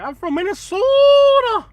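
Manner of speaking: very high, loud mock Minnesotan; Minnesota accent. Mimicking somebody you meet from Minnesota, loud Minnesota accent. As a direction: stretch the O way out, Minnesota style. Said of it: "You ever meet somebody from Minnesota?".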